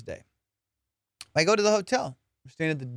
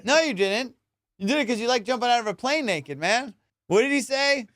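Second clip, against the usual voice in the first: worked-up rant; 6 semitones higher than usual. Real, not acted. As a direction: rant at the absurdity. Ranting about the naked jumper.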